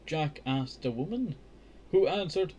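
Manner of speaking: slow, lifted read-aloud voice; careful children's book narration; storybook reading. A narrator's reading. Reads the castle scene.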